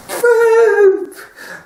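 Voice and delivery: high loud cackle at the punchline; laughing. Real, not acted. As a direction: burst out laughing. Laughs at own joke.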